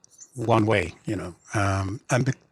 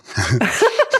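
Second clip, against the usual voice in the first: bright burst of laughter; 8 semitones higher, 6 dB louder than usual. Real, not acted. Author laughing mid-story. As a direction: laughs at own berserker image.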